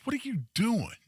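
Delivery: high-pitched retold confrontation from an angry dad; dad's angry demand. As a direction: imitate a dad demanding an answer. Retold dad confronting him in anger.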